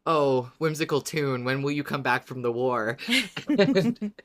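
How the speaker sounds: ordinary delivery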